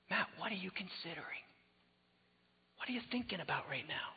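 soft, hushed whisper of God's voice; gentle whisper. Retold or imitated. God gently asking the preacher, whispered. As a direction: breathe it gently, barely voiced. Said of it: "God just very gently just said".

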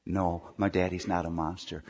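lightly voiced child answering daddy; child's reply. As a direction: mumble it back like a small child.

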